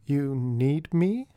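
slow, wary question; suspicious. A narrator's reading. George Joseph wary of Artie, suspicious. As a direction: sound suspicious of the favour. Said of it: "George Joseph sounded suspicious".

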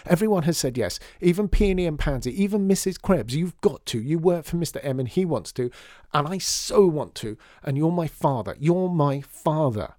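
a daughter raging at her father to agree; exploding. A narrator's reading. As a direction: plead furiously with her father. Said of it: "Lydia exploded".

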